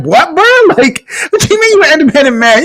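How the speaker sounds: high, disbelieving clowning of a dude; incredulous mock. Mocking a man who brags about independence, incredulous. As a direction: raise it up like you can't believe him.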